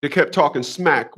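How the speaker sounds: ordinary talk, nothing marked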